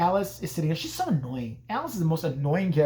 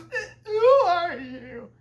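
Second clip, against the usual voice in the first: very slow, high falsetto wail; mock wailing; 12 semitones higher, 4 dB louder, much slower than usual. Alice meeting the old lady, slow mock wail. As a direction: drag it out in hammed-up tearful fear. Imitates Alice sobbing at a stranger.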